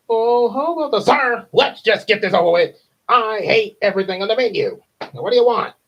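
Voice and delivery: high, loud exasperated cartoon voice; high impatient cartoon voice. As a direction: snap at the customer. Impatient character in episode.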